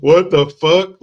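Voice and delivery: loud yelled what the fuck; outraged shout. Retold or imitated. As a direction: blast it out in outrage. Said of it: "I'm just like".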